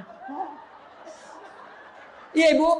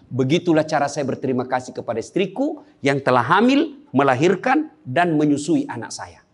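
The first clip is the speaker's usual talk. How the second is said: Umar's solemn answer; 11 semitones lower than usual. Retold or imitated.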